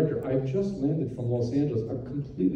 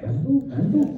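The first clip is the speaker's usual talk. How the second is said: much higher, puzzled squeak; baffled repetition; 10 semitones higher than usual. Retold or imitated. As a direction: echo the word in total confusion. Baffled reaction to Schell's muttering.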